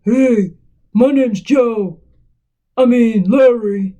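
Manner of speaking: put-on voice of a phone caller; slow, put-on character voice; Larry the caller. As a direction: introduce yourself as an odd caller, slowly. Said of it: "And he's like".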